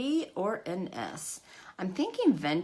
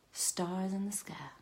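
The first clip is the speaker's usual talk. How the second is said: whisper; 7 dB quieter than usual. Retold or imitated. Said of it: "And then they're like".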